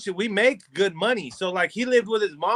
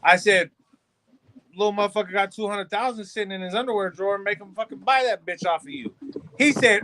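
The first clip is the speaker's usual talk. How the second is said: ordinary talk, nothing marked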